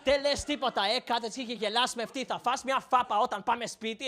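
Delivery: lightly voiced mom muttering threats; scolding Greek mom. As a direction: scold under your breath as mom. Mom scolding child under her breath.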